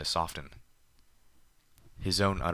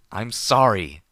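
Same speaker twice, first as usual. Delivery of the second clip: loud, higher yelled sorry; yelled apology; 4 semitones higher, 12 dB louder, slower than usual. Acted. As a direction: shout the apology back at him. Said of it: "I yelled back".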